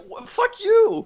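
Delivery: very high, slow mock outrage; indignant retort. Caller answering a scammer's hang-up, mock indignant squeal.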